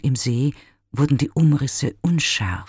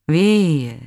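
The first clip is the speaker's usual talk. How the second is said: „Wehe!“ as a distant echo, higher and louder; 5 semitones higher, 5 dB louder than usual. Acted. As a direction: throw the cry back like an echo. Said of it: "mit nachhallender Stimme". („Wehe!“ als ferner, nachhallender Widerhall)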